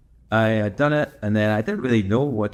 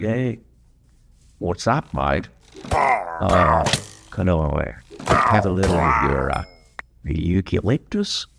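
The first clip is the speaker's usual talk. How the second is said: lower, slower mock Australian koala voice, drawn out on eucalyptus; a comic Australian koala cadging a snack; Australian accent; 4 semitones lower, slower than usual. Koala asking a stranger for eucalyptus, hammed-up Australian accent. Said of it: "as you can tell from my accent".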